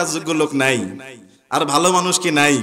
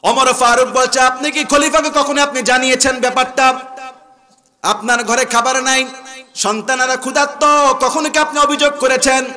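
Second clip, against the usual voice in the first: raised sermon storytelling; high-pitched dramatic retelling from the pulpit; fiery preaching; 8 semitones higher, 5 dB louder than usual. Retold or imitated. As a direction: declaim the tale to the crowd.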